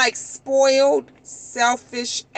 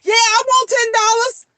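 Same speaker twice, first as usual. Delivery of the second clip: high, loud, eager answer; eager kid's reply; 10 semitones higher, 5 dB louder than usual. Acted. Kid jumps at a whispered ten-dollar offer, eagerly. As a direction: pipe up instantly, high and keen.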